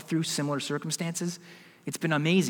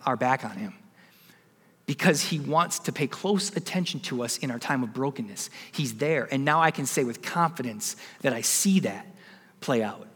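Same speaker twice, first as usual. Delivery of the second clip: ordinary talk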